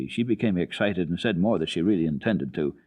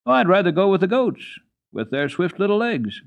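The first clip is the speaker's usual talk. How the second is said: put-on eager child; 11 semitones higher, 6 dB louder than usual. A narrator's reading. Child Heidi voiced.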